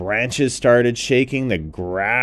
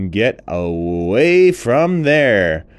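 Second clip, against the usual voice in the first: ordinary delivery, nothing marked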